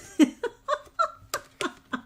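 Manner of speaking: high giggling laugh; high burst of laughter. Real, not acted. Crafter bursts into high laughter on livestream. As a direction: squeal with laughter. Laughing at her own slip.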